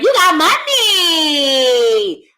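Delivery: high, long-drawn squeal; mock gushing woman. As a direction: squeal it slowly in a put-on voice. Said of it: "Ain't sitting there being like".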